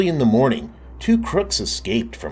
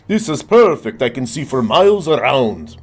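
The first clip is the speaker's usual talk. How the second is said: higher, louder vampire; 5 semitones higher, 6 dB louder than usual. A narrator's reading. Vampire pleased with the tower view, higher louder voice. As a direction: gloat from the balcony. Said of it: "said the vampire".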